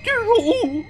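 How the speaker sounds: very high, loud, slow panicked shriek; panicked scream. Retold or imitated. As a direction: scream her out of the bathroom in horror. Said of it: "She has a heart attack".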